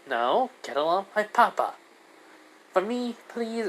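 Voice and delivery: put-on high child voice, coaxing; high-pitched puppy-dog begging; pleading little girl. Child pleads with her papa's rival.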